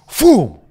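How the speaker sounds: sudden loud, high 'phoom'; whoosh noise. Retold or imitated. Storyteller showing brother the machete throw, explosive whoosh noise. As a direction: imitate a blade launched through the air. Imitates machete flung at tree.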